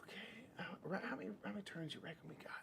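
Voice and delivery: fast whisper. Real, not acted. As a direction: whisper it quickly. Whispered rushed question.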